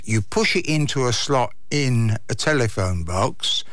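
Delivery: ordinary talk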